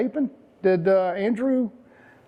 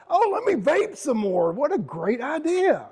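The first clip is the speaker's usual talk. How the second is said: much higher mock-delighted voice, slowing at the end; sarcastic enthusiasm; 9 semitones higher than usual. Vlogger mocking vapers who are out of breath, sarcastic enthusiasm. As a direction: pretend this awful plan thrills you.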